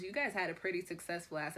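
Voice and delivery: usual speaking voice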